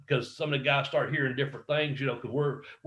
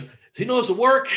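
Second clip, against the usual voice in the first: high, louder incredulous question; retold alarm; 10 semitones higher, 5 dB louder, faster than usual. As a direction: imitate a friend's alarmed disbelief.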